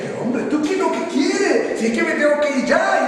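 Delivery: high, loud, half-sung exasperation; an old prophet throwing up his hands; exasperated Elijah. Acted. Elijah pleading with the clinging Eliseo, exasperated.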